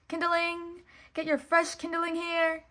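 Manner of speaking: high, loud street-seller call; shouting kid vendor. A narrator's reading. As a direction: hawk your kindling to the street. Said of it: "shouted a young peasant boy".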